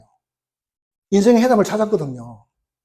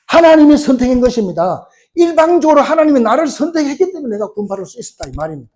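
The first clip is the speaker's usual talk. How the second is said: high, loud exhortation; forceful preaching; 8 semitones higher, 7 dB louder than usual. Real, not acted. Preacher urging congregation to choose faith, forceful preaching. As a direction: press the appeal hard, voice raised.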